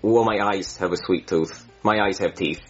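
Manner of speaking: ordinary talk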